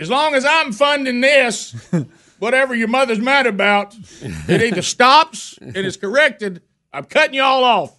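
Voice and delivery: high, slow, laying down the law; boss ultimatum. Retold or imitated. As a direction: cut them off like an employer.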